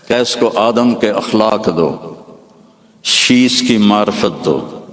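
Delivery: slow, rising sermon cadence; fervent preacher listing blessings; preaching litany. Acted. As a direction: build each line like a proclamation. Litany of gifts from the unseen voice.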